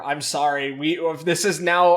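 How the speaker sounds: ordinary talk, nothing marked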